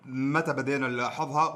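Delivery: ordinary delivery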